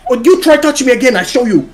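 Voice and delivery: high, quick mock threat; going mental. Imitating a date warning the storyteller off, mock-furious threat. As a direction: imitate an irate Russian date. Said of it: "She's going mental".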